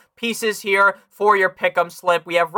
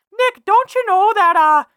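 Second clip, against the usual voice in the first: much higher, mock Canadian whine on don't you know; mimicking a Canadian critic, hammed-up Canadian accent; 12 semitones higher, 4 dB louder than usual. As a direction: lampoon a Canadian doubter, pitched way up. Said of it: "sound like a fucking Canadian".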